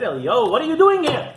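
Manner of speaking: God calling the hiding prophet by name. Acted.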